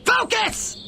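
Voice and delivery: high, sudden snap; exasperated commander; sharp scold. Acted. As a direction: bark it to snap him out of it. Scolding distracted underling.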